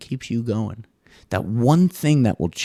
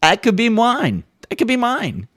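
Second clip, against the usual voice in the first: covetous daydream; 12 semitones higher than usual. Retold or imitated. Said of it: "Meanwhile, I'm like".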